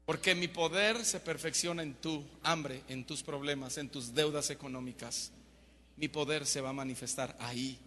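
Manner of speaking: low, soft, solemn voice; quietly delivered promise from God; hushed divine assurance. Acted. God's word of comfort to sufferer.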